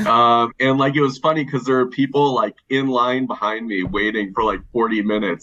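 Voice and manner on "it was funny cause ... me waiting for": ordinary talk, nothing marked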